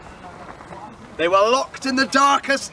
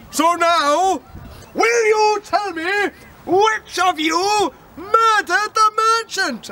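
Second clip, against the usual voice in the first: slow, higher pompous judge; booming judge; 5 semitones higher, slower than usual. Acted. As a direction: pronounce it from the bench. Judge voiced in story.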